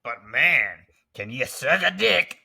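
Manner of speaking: high, slow, smarmy put-down; mock a sleazy casting boss; sleazy producer voice. Mocking the casting director.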